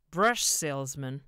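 loud, drawn-out sneer. A narrator's reading. Drunk husband on hearing of the salesman, sneering. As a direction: sneer it back in disbelief. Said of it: "Art sneered".

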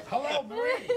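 high, teasing impression of Joe on the phone; imitation of Joe Regano. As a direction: pitch way up to mimic Joe. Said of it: "So I said".

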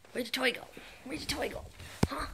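sing-song high voice for the puppy; high dog-talk voice. Real, not acted.

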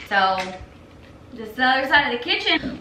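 ordinary talk, nothing marked

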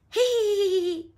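high, loud hee-hee; gleeful giggle. A narrator's reading. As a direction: giggle like a tickled toddler. George's laughter acted by narrator.

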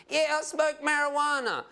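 high-pitched interviewee voice; young marijuana smoker. Acted. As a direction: play the interviewee, pitched well above your own. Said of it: "This young man smoked marijuana".

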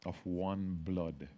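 hushed, reverent scripture reading; solemn recitation. Acted. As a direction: intone the verse slowly, almost to yourself.

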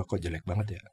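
said low and soft, to himself; a hushed private thought; inner thought. Retold or imitated. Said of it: "Batin gua". (suara batin yang pelan dan rendah)

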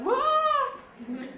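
high, loud mock gasp of amazement; retelling being blown away by the Montreal talk, high surprised whoa; amazed whoa. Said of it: "I'm like".

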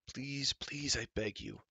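soft, pleading giant's voice; a giant softly begging for mercy; begging cry. Acted. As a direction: beg him softly. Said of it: "cried Glue".

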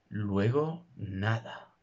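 ordinary talk

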